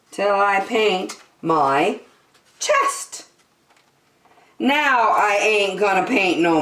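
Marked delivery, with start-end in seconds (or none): 2.6-3.2 squeaky high punchline. A narrator's reading. Reader landing the rhyme for kids, squeaky high. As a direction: jump way up on chest.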